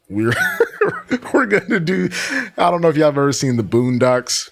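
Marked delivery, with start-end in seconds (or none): 0.4-1.3 high, shrieking laugh; burst of laughter. Real, not acted. Host cackling at Tofia's story, shrieking laugh. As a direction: cackle at how absurd it sounds.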